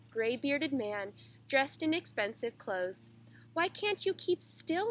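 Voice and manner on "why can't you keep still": higher-pitched scolding at usual volume; mild narrated shout of exasperation; annoyed shout. A narrator's reading. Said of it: "he shouted".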